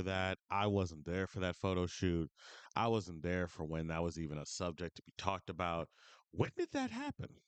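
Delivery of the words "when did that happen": incredulous. Real, not acted. Incredulous rant about Aiden Ross.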